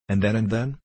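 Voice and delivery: impatient, rising question. A narrator's reading. Aramis urging the young man to go on, impatiently.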